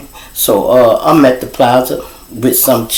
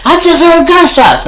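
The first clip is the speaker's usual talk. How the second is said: high, loud, shrill-free demanding mom voice; mother demanding her daughter home, high and loud; angry mother; 5 dB louder than usual. Retold or imitated. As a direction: play the frantic mom yelling down the phone. Mother yelling on phone.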